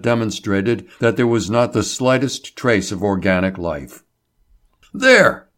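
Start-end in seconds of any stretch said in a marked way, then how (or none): 4.9-5.5 high, loud exclamation; sharp cry of triumph; triumphant cry. A narrator's reading. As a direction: shout it at the proof. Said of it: "Label cried".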